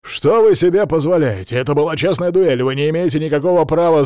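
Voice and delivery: loud, high indignant tirade; angry protest. A narrator's reading. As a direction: protest loudly, outraged father. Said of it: "С рассерженным видом".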